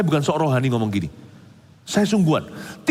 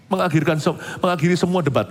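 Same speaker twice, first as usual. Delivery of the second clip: ordinary talk, nothing marked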